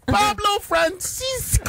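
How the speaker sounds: slow, high drawn-out name call; mock announcer. Host introducing the comedian in a big announcer voice.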